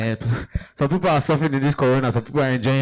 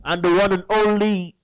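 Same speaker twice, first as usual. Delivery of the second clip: announcer voice; 5 semitones higher, much slower than usual. Acted. Host opens the live show.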